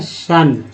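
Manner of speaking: शन said as a short shun; model pronunciation. Shown as a demonstration. Teacher answers the tion question for students, clearly. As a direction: say शन as one clipped syllable shun. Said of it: "T I O N को क्या बोलते हैं".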